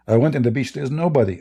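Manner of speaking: normal talking voice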